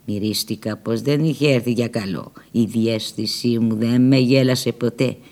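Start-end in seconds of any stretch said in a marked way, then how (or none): none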